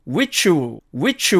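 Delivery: "which" run into a short "u", as "wichu"; contracted which'll. Shown as a demonstration. Teacher shortening which will, contracted. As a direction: add an open L glide after "which". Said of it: "이렇게 발음이 됩니다".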